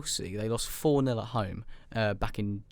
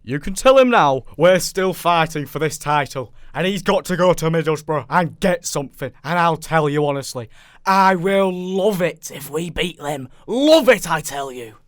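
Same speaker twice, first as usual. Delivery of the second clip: Kevin Keegan rant; 6 semitones higher, 9 dB louder than usual. Acted. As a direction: do a full-throated, emotional Geordie manager losing it on TV. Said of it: "I don't know if I should do it in the accent".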